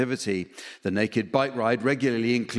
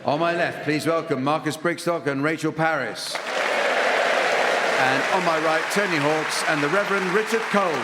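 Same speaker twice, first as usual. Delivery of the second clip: raised, upbeat announcer voice to introduce the panel; announcer intro; 4 semitones higher than usual. Acted. Host welcoming the panel to the audience, bright announcer read. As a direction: present the teams like a game show host.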